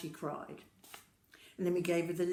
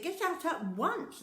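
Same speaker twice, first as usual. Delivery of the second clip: much higher, slow and stern order; sharp command; 11 semitones higher, 3 dB louder, slower than usual. Acted. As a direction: lay down the law to a naughty sister. Older sister commanding.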